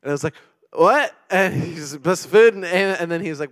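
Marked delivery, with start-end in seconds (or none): 0.7-1.2 high, loud startled yelp; jolted awake and confused; sudden loud confused what. Retold or imitated. 2.0-2.5 loud, higher, emphatic repeat; loud groggy insistence; insistent repeat. Retold or imitated. Repeating the sleepy prayer insistently.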